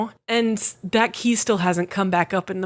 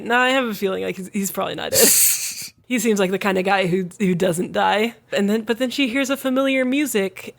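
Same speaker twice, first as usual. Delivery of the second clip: ordinary delivery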